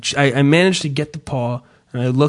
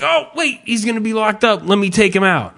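sudden remembering; 7 semitones higher than usual. Retold or imitated. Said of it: "I'm like".

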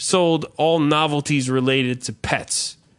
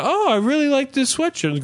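high, chirpy put-on customer voice; mock customer; 11 semitones higher than usual. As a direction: play a gushing shopper, pitched up. Acting out a customer in the shop.

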